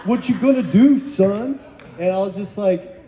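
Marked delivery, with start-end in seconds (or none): 0.0-1.6 higher, taunting mock challenge; taunting method actor. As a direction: goad the young actor like a tough old boss. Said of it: "dude's method".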